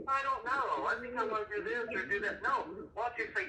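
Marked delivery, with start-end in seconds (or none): 0.1-2.4 put-on higher, aimless teen voice; mock her undecided whine; imitating his daughter. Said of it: "What do you wanna do, Atara?".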